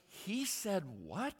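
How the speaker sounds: slow, high disbelief; mock stunned at the news; incredulous what. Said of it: "But then, like".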